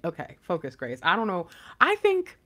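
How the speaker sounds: normal talking voice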